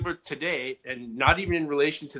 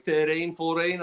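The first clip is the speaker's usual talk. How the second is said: lightly put-on Australian accent; slower than usual. Shown as a demonstration. Showing friends which accents are allowed, light Australian accent. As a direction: try a quick bit of Australian accent. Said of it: "Australians, like, you can do".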